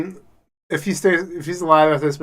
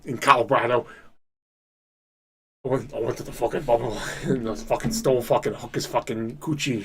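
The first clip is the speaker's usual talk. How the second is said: ordinary delivery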